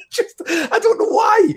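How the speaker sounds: laughing helplessly, pitch flying up; giggling, high and helpless; cracking up. Real, not acted. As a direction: squeeze the words out through a laugh. Cracks up over silly joke.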